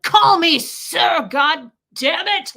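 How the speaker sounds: furious drawn-out shout, high and loud; mad. Retold or imitated. Official blowing up at receptionist who said hi, mad shout. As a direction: bellow it as an outraged big shot. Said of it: "he was so mad".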